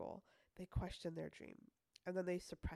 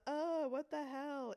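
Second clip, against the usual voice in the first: slow mock shock; 8 semitones higher, 5 dB louder, slower than usual. Replays the startled reaction slowly.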